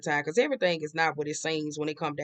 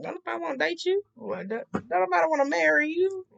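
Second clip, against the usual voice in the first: mocking scold; 10 semitones higher than usual. Mocking the obsessive ex.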